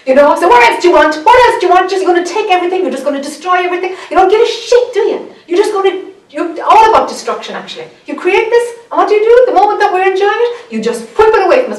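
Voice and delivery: high, loud, furious accusing rant; ranting at the moon. Retold or imitated. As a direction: rail at the sky as if it robbed you. Raging at the moon, retold.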